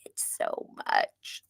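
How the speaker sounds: slow, whispered relish; delighted whisper. Real, not acted. Fan gushing over the chef's performance, delighted whisper. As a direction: draw it out in a soft whisper. Savouring what she loved.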